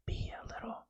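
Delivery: soft airy whisper; breathy whisper. Shown as a demonstration. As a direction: try the words out in a breathy hush. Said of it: "I speak far too fast".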